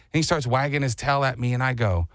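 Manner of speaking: usual speaking voice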